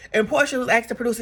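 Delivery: normal talking voice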